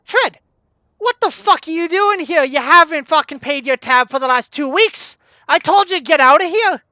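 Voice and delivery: loud, high angry barkeep; furious shout. Acted. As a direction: bawl out a deadbeat customer. Barkeep berates debtor over unpaid tab.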